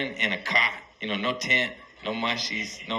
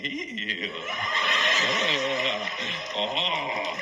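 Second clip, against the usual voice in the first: opera groans. Retold or imitated. Tripping friends groaning like opera.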